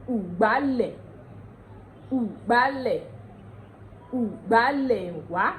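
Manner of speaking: "Ìgbálẹ̀" said as oo-gba-leh; Ondo pronunciation. Shown as a demonstration. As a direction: repeat "Ìgbálẹ̀" with an oo opening. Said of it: "What changed in that sentence".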